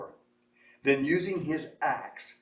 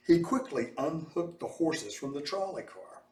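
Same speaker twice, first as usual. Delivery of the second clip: plain reading voice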